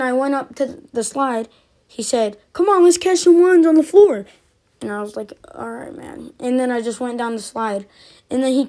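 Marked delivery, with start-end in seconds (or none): 2.5-4.3 higher, louder imitation of a challenger; acted goading challenge. Kid goading the teller at the slide, mock loud challenge. 5.5-6.3 acted reluctant reply. Teller replying to the kid's challenge, quiet and reluctant.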